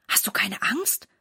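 hushed, mildly fearful question; anxious child. A narrator's reading. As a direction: half-whisper it, a touch afraid. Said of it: "fragt er mit weit aufgerissenen Augen".

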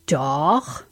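low, drawn reply with rising end; determined. A narrator's reading. Sam answers his brother about the rope ladder, low and determined.

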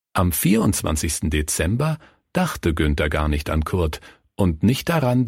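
usual speaking voice

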